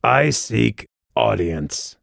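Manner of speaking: slow, formal announcement; measured official voice; on-the-job voice. A narrator's reading. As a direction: announce yourself slowly and officially. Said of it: "what she had dubbed his on-the-job voice".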